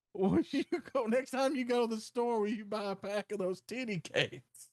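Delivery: high-pitched old-lady impression; mock elderly lady asking a favor; imitated old lady. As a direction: put on an old woman's high voice, easing down at the end. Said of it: "a bunch of old ladies".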